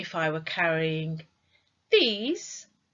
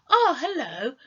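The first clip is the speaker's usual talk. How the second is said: bright rising "Oh, hello"; friendly greeting; 9 semitones higher, 6 dB louder than usual. Shown as a demonstration. Teacher shows students a greeting. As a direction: greet a friend you just spotted. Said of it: "we might go".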